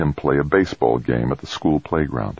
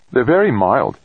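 lightly voiced character; 8 semitones higher than usual. A narrator's reading. V J voiced in narration.

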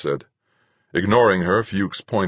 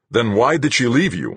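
louder, higher challenge; demanding; 8 semitones higher, 6 dB louder than usual. A narrator's reading. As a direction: demand an explanation from him. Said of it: "I demanded".

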